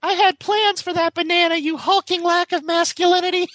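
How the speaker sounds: high-pitched put-on woman's voice; hammed-up falsetto lady impression; high mock woman voice. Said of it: "that shitty impersonation of someone".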